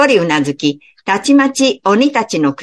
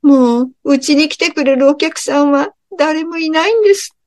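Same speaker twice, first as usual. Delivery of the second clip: tearful; 8 semitones higher than usual. Acted. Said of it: "奥さんが涙声で言いました".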